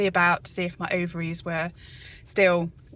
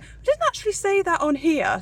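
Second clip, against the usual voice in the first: higher, louder imitation of a clinician; quoted clinician; 10 semitones higher, 5 dB louder than usual. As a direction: voice her pointing out what the letter says, pitched up. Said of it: "then she was like".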